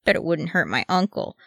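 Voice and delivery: plain reading voice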